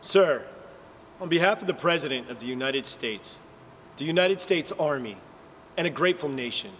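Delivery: low, sincere, measured condolence. Shown as a demonstration. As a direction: offer it gravely to the family. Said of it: "speak in a sincere tone of voice".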